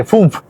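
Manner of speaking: "fünf" with umlauted ü; German ü sound. Shown as a demonstration. Teacher showing the different sound, "fünf" with German ü. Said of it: "Suena un poquito diferente".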